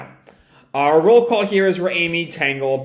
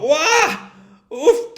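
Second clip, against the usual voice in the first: yelping; 12 semitones higher than usual. Retold or imitated. Log spins, character yelps.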